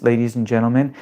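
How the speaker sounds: ordinary talk, nothing marked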